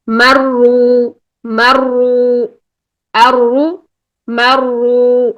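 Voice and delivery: مرو held very slowly with a full mouth; slow heavy-ra demo; heavy mouth-filling ra. Shown as a demonstration. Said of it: "منہ بھر کے".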